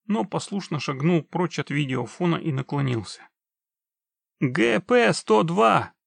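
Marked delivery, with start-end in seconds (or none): 4.4-5.9 high, loud, slow read-out; anxious reading out. A narrator's reading. Trapped man reads the plate for witcher, anxious. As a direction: call out each character urgently.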